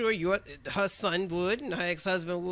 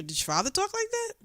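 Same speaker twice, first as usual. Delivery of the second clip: much higher pitch, leading question; retold pointed question; 12 semitones higher than usual. Woman challenging coworker about her late father, high pointed question.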